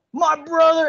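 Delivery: high, loud, drawn-out wrestler voice; hyped wrestler voice; Macho Man voice. Retold or imitated. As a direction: boom it out like a wrestling promo. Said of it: "gonna do a bad Macho Man impression".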